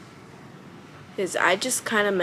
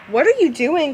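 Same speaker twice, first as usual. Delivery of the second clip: sudden high loud what-are-you-doing; startled call to pet; 7 semitones higher, 7 dB louder than usual. Real, not acted. Owner startled by her pet Cupcake, calling out high and loud. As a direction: catch the pet mid-mischief, higher and louder.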